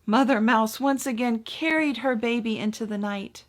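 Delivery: usual speaking voice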